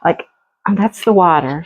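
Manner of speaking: normal talking voice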